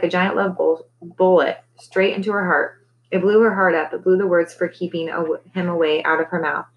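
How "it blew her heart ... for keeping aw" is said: plain reading voice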